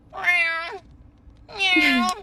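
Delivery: lightly mimicked cat meow. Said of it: "it's more like".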